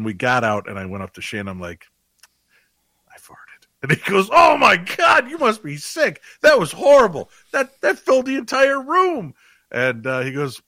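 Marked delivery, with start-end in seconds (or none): 3.1-3.7 very soft voiceless whisper; secretive hushed admission; whispered confession. Retold or imitated. 4.3-9.3 very high, loud mock outrage; hammed-up disgusted yelling; appalled outburst. Acts friend's disgusted outburst.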